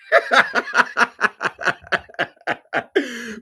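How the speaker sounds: long, high peal of real laughter. Real, not acted. Host tickled by the caller's wording, in long laughter. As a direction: crack up and keep laughing. Cracking up at "fail miserably.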